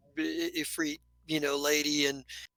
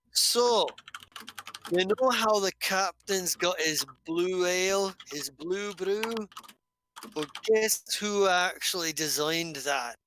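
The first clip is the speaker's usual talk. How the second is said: proud dwarf; 2 semitones higher than usual. Acted. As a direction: lean in and brag about the ale. Dwarf boasts about ale.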